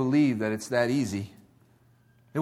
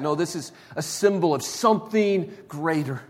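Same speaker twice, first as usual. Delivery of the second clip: ordinary delivery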